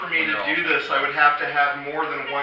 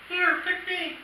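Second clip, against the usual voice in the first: squeaky card voice; 3 dB quieter, slower than usual. Pretend. Card voices itself, joke.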